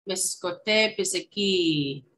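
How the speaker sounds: Miskote biziki said slowly, syllable by syllable for learners; slow teaching demo. Shown as a demonstration. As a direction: draw out Miskote biziki for the class. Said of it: "teaching Cree word slowly".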